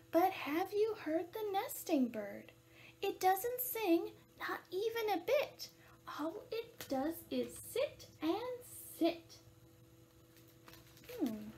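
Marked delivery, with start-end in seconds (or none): 0.1-9.4 slow, lilting picture-book narration; animated storytime voice; reading to children. A narrator's reading. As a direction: read it out deliberately for little listeners.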